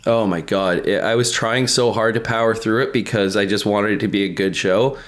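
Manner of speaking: ordinary talk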